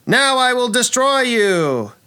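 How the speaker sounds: high, hammed-up villain cry; snake villainess threat. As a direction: threaten grandly like a stage villainess. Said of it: "And she says".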